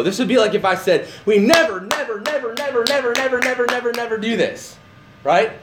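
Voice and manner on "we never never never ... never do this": NEVER repeated over and over in a high, unbroken run; emphatic repetition. Shown as a demonstration. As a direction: pile up NEVER on a sustained high pitch, then drop at the end. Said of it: "it's just an emphatic never".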